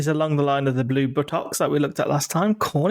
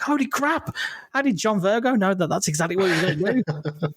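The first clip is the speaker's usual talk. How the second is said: high-pitched mock amazement; impressed astonishment; 5 semitones higher than usual. Snooker fan marvels at the TV pundit's prediction, mock amazement. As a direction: gush in awe at the pundit's prediction. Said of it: "you're all impressed".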